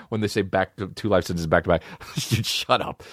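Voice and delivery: usual speaking voice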